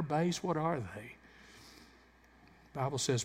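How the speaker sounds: ordinary talk, nothing marked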